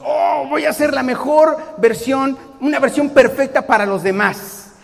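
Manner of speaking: loud, bright overeager vow; eager mock enthusiasm. A newcomer promising to be perfect, overeager and loud. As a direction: play the idealistic newcomer, overdoing it. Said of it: "nadie busca ... pensando en ser la mejor persona".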